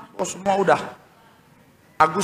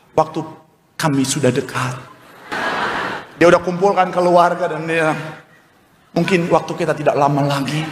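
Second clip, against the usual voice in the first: doomed pig parent; 5 semitones lower than usual. Acted. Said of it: "itu babi-babi langsung".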